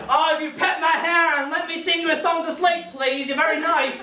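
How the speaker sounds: high, hammed-up pleading; intense true self. True me begging a date to cuddle, mock desperate plea. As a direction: beg with overblown, needy passion. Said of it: "the real me is a very intense being".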